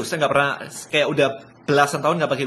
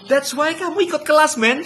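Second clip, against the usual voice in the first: high-pitched, louder exasperated retort; exasperated outburst; 10 semitones higher, 3 dB louder than usual. Real, not acted. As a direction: throw the answer back in disbelief, up high. Exasperated reply to student excuse.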